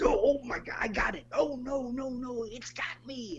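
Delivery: hammed-up alarm, high pitched; mock panic. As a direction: play-act being grabbed by a creature. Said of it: "sticks his hand up this pipe and goes".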